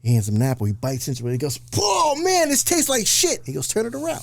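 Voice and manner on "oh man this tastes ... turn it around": cartoonish disgusted shout at the punchline; mock yell. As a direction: yell it way up in pitch like he bit something foul. Punchline character yelling in joke.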